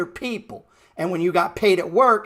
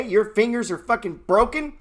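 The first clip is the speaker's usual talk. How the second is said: ordinary talk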